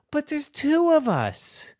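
put-on high, slow child protest; little girl voice. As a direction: plead like a small girl.

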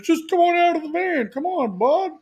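dad coaxing his son out of the van. Retold or imitated. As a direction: lure the kid out of the van. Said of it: "he's like".

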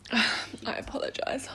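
sheepish whisper after a sigh; whispered apology. Pretend. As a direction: whisper a mock-contrite sorry. Mock apology after being scolded.

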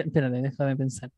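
ordinary talk

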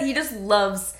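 usual speaking voice

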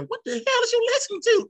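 high, sharp wife voice; imitating wife. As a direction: snap at him like a nagging wife. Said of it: "I hear my wife now".

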